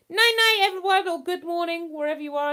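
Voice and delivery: high, loud cheery farewell; cheery goodbye. Real, not acted. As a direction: sing out the goodnight. Cheery sign-off to viewers.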